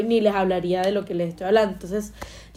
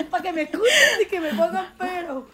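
high, laughing burst of agreement; 8 semitones higher than usual. Real, not acted. Host laughs along at her podcast joke, high and amused.

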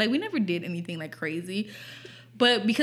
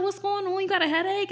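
high-pitched imitation of a nurse checking in; friendly nurse; 11 semitones higher, faster than usual. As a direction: ask lightly, pitched up like a school nurse. Acting out nurse's casual question.

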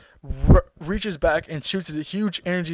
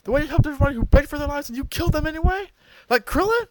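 imitating angry yell; 10 semitones higher, 3 dB louder than usual. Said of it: "Goku turns around yelling".

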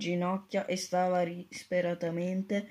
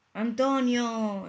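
drawn-out call of the name, higher; exclaiming; 4 semitones higher, 3 dB louder, much slower than usual. A narrator's reading. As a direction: call out Antonio slowly and high. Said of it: "esclamò Matteo".

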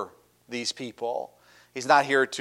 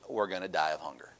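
mock doom; 8 semitones lower, 12 dB quieter than usual. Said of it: "A little overdramatic".

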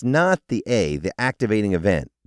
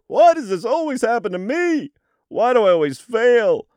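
much higher, loud whiny voice; weird negative voice; 5 dB louder than usual. Pretend. Someone asking themselves why they always fail, whining. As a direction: moan it in that silly put-on voice.

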